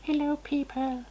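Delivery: very high squeaky alien conqueror; arrogant alien overlord. Pretend. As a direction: play a tiny alien claiming Earth. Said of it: "sorry about that voice".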